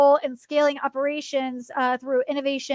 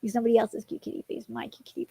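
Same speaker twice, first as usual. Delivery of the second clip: normal talking voice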